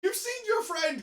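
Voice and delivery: loud, very high incredulous shout; laughing outrage. Real, not acted. Co-host objecting to stealing somebody's coach, outraged laughing shout. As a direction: yell it slowly in disbelief, laughing.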